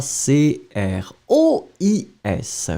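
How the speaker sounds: slow letter-by-letter spelling. Shown as a demonstration. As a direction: spell it out slowly. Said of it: "It will be je crois".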